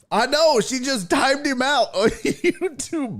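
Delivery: high, delighted, cracking up; real amused outburst; laughing disbelief. Real, not acted. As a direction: burst into laughter before the last word. Laughing at John's timeout.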